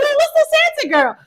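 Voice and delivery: high-pitched mock confusion; retelling her own baffled reply; high puzzled question. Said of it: "I was like".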